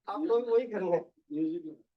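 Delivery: ordinary talk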